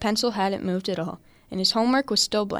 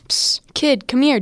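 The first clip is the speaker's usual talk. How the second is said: a louder hissed call across the schoolyard; calling out to a kid; 5 semitones higher, 7 dB louder than usual. Acted. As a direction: beckon the boy over, higher and louder. Stranger calling kid over.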